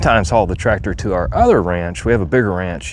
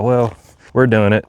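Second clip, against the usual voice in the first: ordinary delivery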